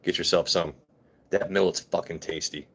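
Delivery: normal talking voice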